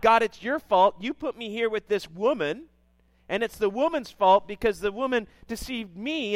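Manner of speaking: loud, accusing mock complaint; Adam blaming God. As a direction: point the finger hard at God. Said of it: "He blames God".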